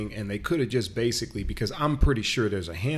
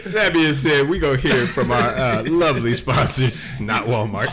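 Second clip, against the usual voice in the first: radio host hype; 4 semitones higher, 10 dB louder than usual. Acted. Loud hype sponsor break announcement.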